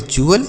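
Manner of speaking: चुअल opening on CH, rising at the end; चुअल with CH in place of T; correct pronunciation. Shown as a demonstration. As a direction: say चुअल as "choo-al", lifted. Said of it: "क्या पढ़ते हैं".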